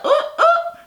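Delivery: very high-pitched little "oh, oh"; mock "oh, oh". Recapper imitating a moment from the show, mock high oh-oh. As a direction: squeak out two short ohs.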